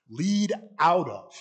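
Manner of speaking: "To lead out of" slow, with weight on "lead"; spelled-out definition. Shown as a demonstration. Teacher defining exegesis for the class, slow and stressed. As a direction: stretch "To lead out of" and hit "lead". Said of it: "Greek form".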